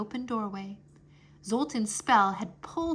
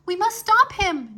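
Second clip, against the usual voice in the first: high, loud urgent cry; 11 semitones higher, 8 dB louder than usual. Acted. Nuna urging the others to stop Zoltan, loud cry. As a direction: call out in alarm. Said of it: "Nuna cried".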